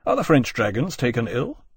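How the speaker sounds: ordinary delivery, nothing marked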